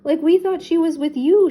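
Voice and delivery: high, loud, alarmed reply; worried Shaggy. A narrator's reading. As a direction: blurt it out in dismay. Shaggy answers in worried surprise.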